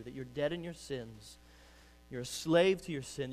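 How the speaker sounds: ordinary delivery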